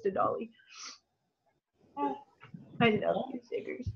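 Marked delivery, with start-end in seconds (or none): 2.0-3.9 much softer, very slow, loving murmur; tender. Real, not acted. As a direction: coo it to the little one. Talking softly to her baby.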